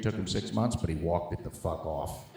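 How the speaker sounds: ordinary talk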